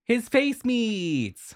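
high, slow, amazed cry; excited. Real, not acted. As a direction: gasp it out in delight.

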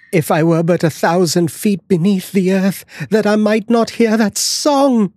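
high, pleading cry; frightened cry. Acted. The wife begs her husband to stay, high frightened cry. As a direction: beg him not to go, afraid. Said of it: "cried the wife".

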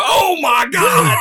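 slow, high shocked yell; shocked shout. Retold or imitated. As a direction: stretch it out in horror. Coach reacting to injury.

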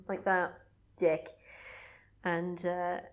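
ordinary talk, nothing marked

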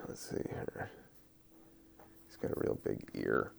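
half-whispered mutter under the breath; whispered to self. Real, not acted. Podcaster mutters to himself while doodling, whispering. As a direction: mutter it to yourself while drawing.

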